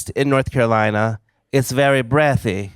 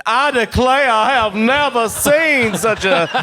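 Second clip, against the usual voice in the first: high, slow, stretched Hollywood Southern drawl; Gone with the Wind Southern accent; 10 semitones higher, 3 dB louder, slower than usual. Retold or imitated. Comedian mocking how Hollywood does the South, hammed-up Southern drawl. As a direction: overdo an old-movie Southern accent. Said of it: "does the Gone with the Wind".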